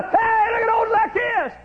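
high, loud mocking holler; jeering shout. Crowd shouting up at Zacchaeus in the tree. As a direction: call out to shame him.

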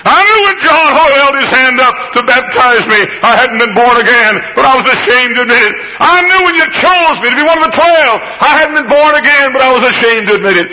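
high, loud preaching cry; impassioned confession. Acted. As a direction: plead it out in rising fervor. Preaching at full force.

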